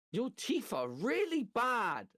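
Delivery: put-on high, slow little boy voice; imitating his son. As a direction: drawl it out like a blunt four-year-old. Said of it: "he goes".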